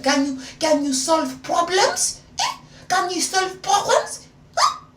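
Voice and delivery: loud, high mock French accent, rising sharply; put-on French accent. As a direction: challenge him in a hammed-up French accent. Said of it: "I still had the accent".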